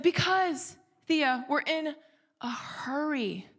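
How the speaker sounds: retold impatient mom, stretching out "hurry"; added passion. Rushed mother answers daughter's whys, with added passion. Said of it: "let's just say added some passion to it".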